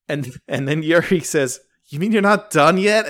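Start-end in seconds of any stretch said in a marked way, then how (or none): 1.9-3.1 higher-pitched, retold disbelief; lightly played alarm; mock shock. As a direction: act out a worried, shocked question. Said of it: "looks shocked, uh, worried, shocked face".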